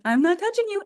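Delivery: high, taunting denial; childish teasing. Acted. Pest bugging someone up close, childishly teasing.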